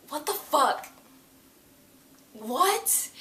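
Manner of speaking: high, incredulous questioning; baffled disbelief. Retold or imitated. Fan stunned by the dad reveal, baffled disbelief. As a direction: react high-pitched to an absurd twist. Stunned reaction to plot twist.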